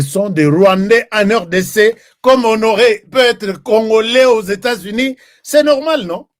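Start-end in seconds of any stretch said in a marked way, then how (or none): none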